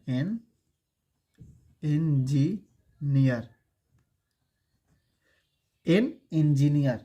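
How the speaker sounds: एन, a pause, then engineer stretched very slowly; teacher modelling एन engineer slowly; slow teaching demo. Shown as a demonstration. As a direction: drag out engineer for the class. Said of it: "अगर लिखे".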